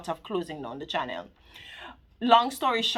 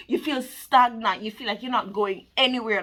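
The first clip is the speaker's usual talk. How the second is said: ordinary delivery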